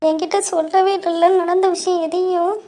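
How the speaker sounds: high-pitched tearful complaint; crying. Acted. Daughter hurt at not being told what happened, crying. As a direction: complain through tears, voice high. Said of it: "நீ இப்ப அழுது".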